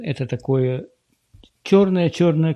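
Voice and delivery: ordinary talk, nothing marked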